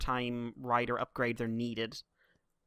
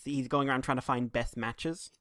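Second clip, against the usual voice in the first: ordinary talk, nothing marked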